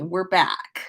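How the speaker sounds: plain reading voice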